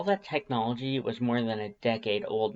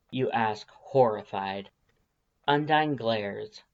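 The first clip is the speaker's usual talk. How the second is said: usual speaking voice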